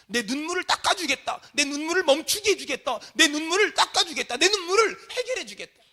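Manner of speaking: high, loud promise, climbing with each repeat; building impassioned repetition; forceful preaching. Acted. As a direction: proclaim it as a divine pledge. Proclaims Jesus' promise, building.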